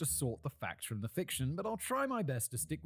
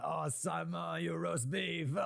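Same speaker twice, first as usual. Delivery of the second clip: slow, higher-pitched caricature Frenchman; mock French accent; 5 semitones higher, much slower than usual. French listeners ribbing the British presenter, mock French accent. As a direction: tease in a hammed-up French accent. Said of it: "French people listening to this".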